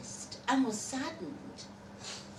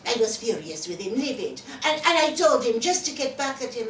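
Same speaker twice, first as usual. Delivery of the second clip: agitated; 4 semitones higher, 6 dB louder, faster than usual. Acted. Telling of a furious row.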